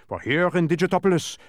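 high, loud, precise reproof; admonishing. A narrator's reading. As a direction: lay down the rule emphatically. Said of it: "admonished the dodecahedron from his admonishing face".

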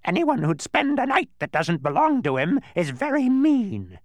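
high, loud bird screech; shrill-pitched, scolding bird character. A narrator's reading. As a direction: squawk out a scolding at full volume. Narrator voices the scolding bird.

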